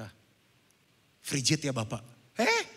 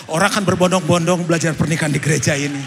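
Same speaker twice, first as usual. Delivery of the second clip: "berbondong-bondong" thundered out, much louder and higher; booming proclamation; 5 semitones higher, 17 dB louder than usual. Shown as a demonstration. Preacher to congregation, booming proclamation. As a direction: preach it to the whole hall. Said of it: "udah puji gereja ini berapa kali".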